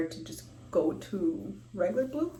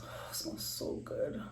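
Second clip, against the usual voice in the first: whispering; 6 dB quieter than usual. Real, not acted. Sniffing the cleanser, pleased.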